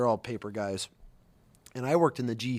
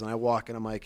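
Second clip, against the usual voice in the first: plain reading voice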